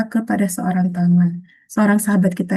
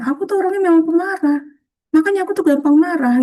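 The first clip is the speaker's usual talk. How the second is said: higher, whiny put-on voice for a bad habit; mock self-labelling whine; 7 semitones higher, faster than usual. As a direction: play the sulky self-labeller, pitched up. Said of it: "Daripada kita ngomong misalnya".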